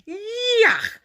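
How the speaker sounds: high, loud disgusted monster yelp; Grover disgusted. A narrator's reading. Grover tasting strawberry jelly, high and disgusted. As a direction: spit it out in disgust.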